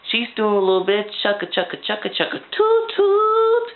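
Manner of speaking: chugga chugga rising into a high sung toot toot; train noises. Retold or imitated. Reviewer mimicking a train for viewers, sung high toot. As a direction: play choo-choo train, ending in a high whistle.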